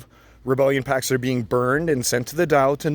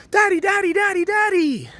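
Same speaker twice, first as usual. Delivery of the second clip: put-on high childish "daddy" chant; squeaky kid voice calling out. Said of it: "get abused by my one, three, five, and seven-year-old".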